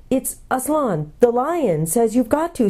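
high-pitched, exasperated pleading; despairing. A narrator's reading. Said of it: "said Jill despairingly".

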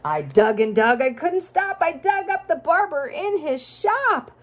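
sing-song rhyming storybook read; reading to children. A narrator's reading. Parent reading a rhyming picture book to a child, sing-song. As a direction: lilt the rhymes for a child listener.

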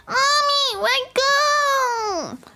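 put-on high, squeezed toddler refusal; whining baby. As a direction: play a fussy baby protesting. Plays the baby.

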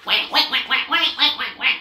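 high, loud little quacks; duckling quacks. Acted. Ducklings quacking back at the traffic, loud. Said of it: "went Jack, Cack, Lack, Mack".

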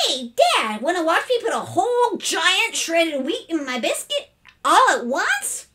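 put-on high, excited boyish pitch; eager. A narrator's reading. Boy offering his father a breakfast stunt, eager. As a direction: bubble over with a show-off offer. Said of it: "eagerly at his father".

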